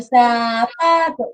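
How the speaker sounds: put-on "zapato" said slowly, syllable by syllable, like a kid jumping; child repeating syllables. A child repeating "zapato" while jumping, slow syllables. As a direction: echo "zapato" in slow separate beats. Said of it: "El niño".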